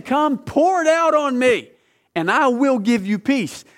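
high, slow, impassioned call; emphatic divine invitation. Acted. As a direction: preach it as a big open invitation. Voices God's repeated invitation.

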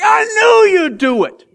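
high, loud, slow exasperated yell; angry Jonah shout. Acted. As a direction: blow up at God for being merciful. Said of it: "He's like".

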